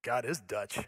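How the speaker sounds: low, soft and dead serious; deadpan local man insisting on it. Retold or imitated. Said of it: "Again, straight face".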